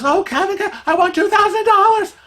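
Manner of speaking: high, fast excited squeal; thrilled lady. Retold or imitated. Casino friend telling Kevin about her win, thrilled. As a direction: burst with the news of the win. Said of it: "she goes".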